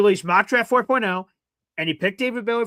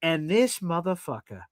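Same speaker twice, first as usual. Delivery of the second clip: normal talking voice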